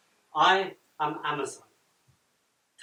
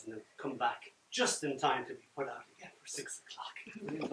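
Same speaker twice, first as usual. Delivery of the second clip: whisper; 3 semitones lower, 6 dB quieter than usual. Real, not acted. Whispered end of anecdote.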